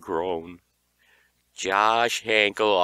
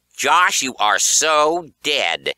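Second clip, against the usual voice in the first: loud, slow, menacing big-sister threat; furious sister threatening her brother; angry threat; 5 semitones higher, 8 dB louder, slower than usual. Acted. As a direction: spit the threat at your brother. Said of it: "Georgia exclaimed".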